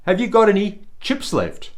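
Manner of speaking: high, loud call across the shop; cheeky schoolboy calling across a chip shop. Acted. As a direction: shout it to the shop owner. Boys call out prank.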